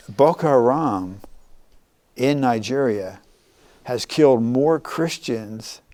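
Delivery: spaced-out phrases with pauses between; slow and deliberate. Real, not acted. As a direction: say it slowly so the disbelieving take it in. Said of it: "I'm speaking slowly".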